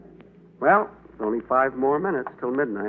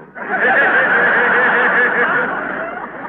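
loud laugh; 11 semitones higher, 6 dB louder than usual. Acted. Laughs at own joke.

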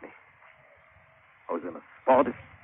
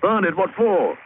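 shocked; 8 semitones higher, 4 dB louder than usual. Real, not acted. Shocked his ship was burned.

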